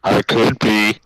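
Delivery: suddenly loud and booming. Shown as a demonstration. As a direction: blast it into the mic. Mic level check, showing loudness.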